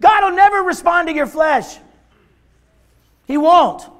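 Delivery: loud, high-pitched sermon delivery; full-force preaching. Real, not acted. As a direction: preach it out hard, stretching the key words.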